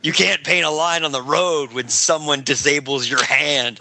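ordinary talk

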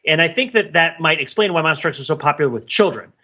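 ordinary talk

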